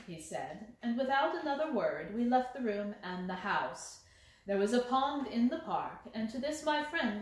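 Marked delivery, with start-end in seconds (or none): none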